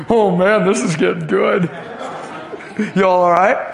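real laughter breaking into the line; chuckling while asking the crowd; laughing. Real, not acted. Preacher laughing at his story.

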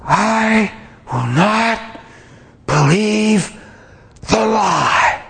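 drawn-out, high, crying shout; passionate yell. Retold or imitated. Preacher shouting defiance at the attack, crying yell. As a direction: cry it out slowly with everything in you. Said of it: "I yelled it loud".

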